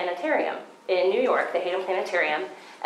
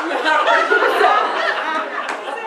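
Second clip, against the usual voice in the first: high, loud burst of real laughter; big laugh; 9 semitones higher, 6 dB louder than usual. Real, not acted. Laughing at Sonia's reminder in front of the audience, a loud high laugh. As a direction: laugh out loud at the friend's teasing.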